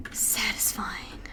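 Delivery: slow savouring whisper. Real, not acted. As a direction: breathe it out, relishing. Savouring thunder sound.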